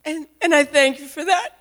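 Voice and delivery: ordinary delivery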